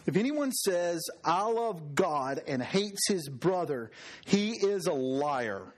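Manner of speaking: deliberate scripture reading, words drawn out; slow, weighty reading. Real, not acted. Preacher reads John to the congregation, slow and weighty. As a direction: read the verse slowly, letting each phrase land. Reads the verse, then repeats it.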